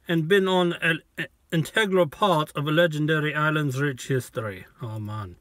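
put-on Jamaican accent with a lifted, sing-song tone; reading the bottle's history in a hammed-up Jamaican accent. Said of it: "but I like doing the accent".

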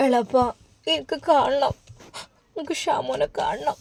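put-on a young wife sobbing to see her husband; sobbing like a child. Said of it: "കൊച്ചുകുട്ടിയെ പോലെ നുസൈബ വിതുമ്പുകയാണ്".

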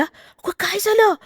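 high, alarmed women's voices; startled village women crying out questions; frightened women asking. A narrator's reading. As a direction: ask in high-pitched alarm. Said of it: "विचारू लागल्या".

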